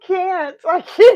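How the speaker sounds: squeaky breaking laugh; can barely get words out. Real, not acted. Laughing too hard to speak.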